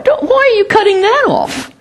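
high, pleading protest; mock protest to God. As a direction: whine at God for cutting the shoot. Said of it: "And you say".

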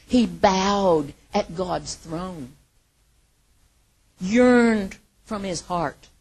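loud, very slow, drawn out; slow earnest preaching. Acted. Preacher describing David bowing, slow and loud. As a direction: lean on every word.

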